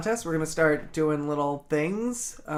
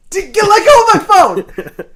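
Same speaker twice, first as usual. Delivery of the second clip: laughing yell; 13 semitones higher, 13 dB louder than usual. Real, not acted. Friend grabs his phone, laughing.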